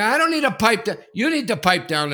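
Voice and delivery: loud, high defiant snap back; angry retort. Retold or imitated. Dad snaps back at the volunteer in anger. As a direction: throw it right back at him. Retold lobby shouting match.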